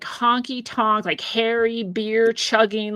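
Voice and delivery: normal talking voice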